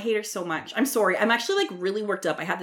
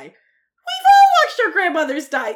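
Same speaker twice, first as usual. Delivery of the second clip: exasperated laughing shout; 10 semitones higher, 10 dB louder than usual. Real, not acted. Exasperated rant about Erica.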